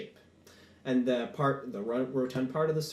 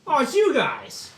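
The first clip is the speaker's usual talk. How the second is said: high, loud cry of surprise; surprised; 14 semitones higher, 8 dB louder, slower than usual. Acted. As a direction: burst out on a startled, delighted greeting. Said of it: "you sound surprised".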